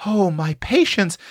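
high, flustered delight; high-pitched, gleeful country-girl fluster; exclaiming. A narrator's reading. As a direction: exclaim like an excited young cook. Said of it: "exclaimed Tilly".

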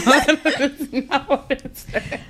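giggling helplessly through the words; can't stop laughing while reading. Real, not acted. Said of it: "I can't stop laughing".

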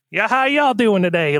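a friendly cartoon Southern hello, half-hearted imitation. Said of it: "like, one of those voices".

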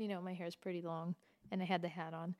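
ordinary talk

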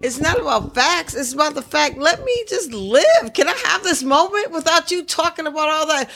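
high, indignant pleading; exasperated plea. Real, not acted. Host pleads with brother to let him celebrate, exasperated. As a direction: beg him to back off, pitch climbing.

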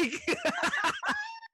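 high shrieking laugh bursting out; burst of high laughter. Real, not acted. Cracking up over a bad movie boyfriend, high shrieking laugh.

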